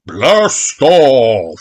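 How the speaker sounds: big booming launch call. Acted. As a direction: boom it like a rocket announcer. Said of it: "a voice booms".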